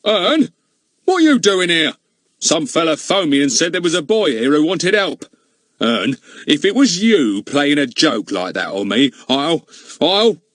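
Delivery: loud, blustering policeman; angry policeman. Acted. Goon shouting at Ern in the snowy night, angry and loud. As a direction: bellow at the boy, furious. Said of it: "a loud and angry voice hailed him".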